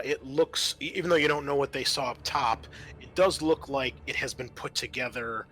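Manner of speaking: ordinary delivery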